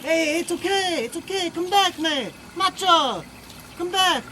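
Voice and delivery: soothing the bird. Real, not acted.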